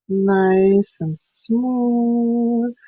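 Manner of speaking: very slow, stretched-out words; drawn-out coaching. Real, not acted. Teacher guiding brush work on the table, slow drawn-out delivery. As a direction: draw each word out long and smooth.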